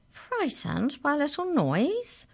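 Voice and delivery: slow, high-pitched canine voice; incredulous canine leader. Acted. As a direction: draw out the disbelief, pitched high. Canine leader scoffs at fear.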